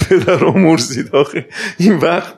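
slow, laughing disbelief; laughing protest. Retold or imitated. Laughing at the policeman who fined him at dawn. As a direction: laugh through the complaint.

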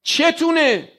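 sharp pulpit scolding. Real, not acted. Preacher scolds the congregation.

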